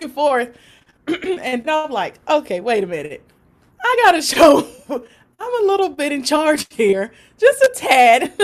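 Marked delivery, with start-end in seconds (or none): 2.3-8.3 louder, higher laughing pep talk; laughing self-talk. Retold or imitated. Bracing herself before the mom, loud and laughing. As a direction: psych yourself up through laughter.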